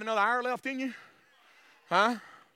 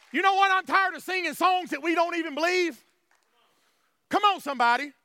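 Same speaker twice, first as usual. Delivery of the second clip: raised, insistent preacher's voice; fervent preaching; 6 semitones higher, 4 dB louder than usual. Real, not acted. Preacher tired of songs nobody believes, fervent preaching. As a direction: preach it with fed-up conviction.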